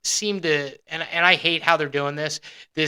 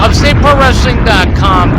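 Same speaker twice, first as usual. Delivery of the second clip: echoing promo tagline; 5 semitones higher, 10 dB louder than usual. Retold or imitated.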